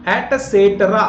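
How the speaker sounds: एटसेटरा as "et-se-tra", with a t and no k; correct pronunciation. Shown as a demonstration. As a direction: say एटसेटरा clearly as "et-set-ra". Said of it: "full form होता है एटसेटरा".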